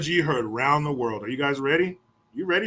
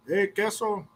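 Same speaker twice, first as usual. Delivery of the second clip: higher-pitched mock kid greeting; teasing kids; 5 semitones higher, slower than usual. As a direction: call out the nickname like a kid. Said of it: "All the Mexican kids".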